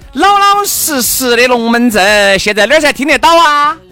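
self Q&A hype voice. Acted. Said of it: "你看我这种自问自答的包装".

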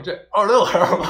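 laughing while teasing. Real, not acted. Teasing host's slip, laughing.

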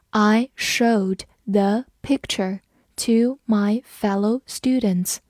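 slow, careful read of the sentence. Shown as a demonstration. Teacher reading slowly for students to follow. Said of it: "我们慢读一遍".

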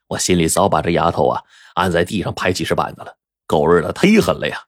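ordinary delivery, nothing marked

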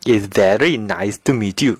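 put-on a light Indian English accent with a sing-song lilt; Indian accent imitation. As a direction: say it like an Indian speaker, with a sing-song rise and fall. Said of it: "有一些印度人呢，可能会这样说". (模仿印度口音，抑扬顿挫)

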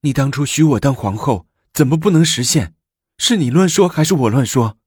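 high-pitched, argumentative protest; quarrelling. A narrator's reading. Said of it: "萧妃紧盯着李治吵吵着". (高声调地吵吵着质问)

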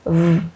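"嗯" as a buzzing v, vocal cords vibrating; bare voiced fricative v on "嗯"; voiced V sound. Shown as a demonstration. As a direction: hum the consonant v for "嗯", teeth on lip. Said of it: "这个是声带要震动读".

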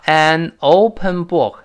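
an open book very slow, joined; slow an-open-book linked; slow linking. Shown as a demonstration. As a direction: stretch an open book together. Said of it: "后面加".

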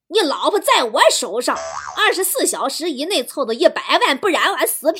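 loud, swooping ransom demand; hammed-up villain threatening over the phone; kidnapper. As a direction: bellow the threat like a comic kidnapper. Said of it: "绑匪打电话过来说".

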